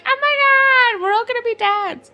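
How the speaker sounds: high, loud mock squeal of joy; hammed-up delight; mock excitement. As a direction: characters thrilled to become dads, mock excited squeal. Characters react to pregnancy reveal.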